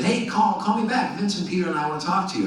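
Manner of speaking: lightly voiced caller, pitched high; high-pitched retold phone greeting; producer on the phone. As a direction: imitate a producer calling, high and quick by the end.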